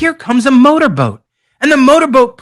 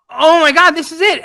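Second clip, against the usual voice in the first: a higher, relieved cry of joy; light up on finding the way; mock relief at spotting the bridge; excited discovery; 7 semitones higher than usual.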